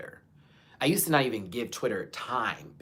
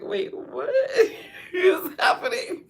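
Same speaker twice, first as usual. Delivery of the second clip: squeaky, much higher laughing question, slow; 5 dB louder, slower than usual. Real, not acted. Retelling her confusion at the chair, squeaky laughing.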